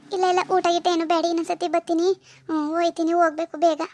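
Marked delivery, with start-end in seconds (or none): none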